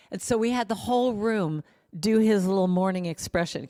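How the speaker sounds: normal talking voice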